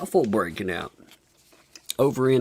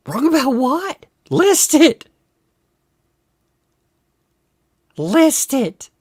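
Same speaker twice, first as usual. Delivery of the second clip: much higher, louder hammed-up demand; mock challenge; 6 dB louder than usual. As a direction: squeak out a snippy challenge. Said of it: "Jokalist is like".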